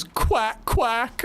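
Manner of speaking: high squeaky duck quacks; mock quacking. Laura Palmer quacking at her boyfriend, high mock quacks. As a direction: quack like a silly cartoon duck. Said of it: "and she goes".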